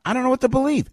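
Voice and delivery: high, loud exasperated outburst; exasperated shout. Real, not acted. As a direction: throw up your hands and shout it. Exasperated rant about balloon.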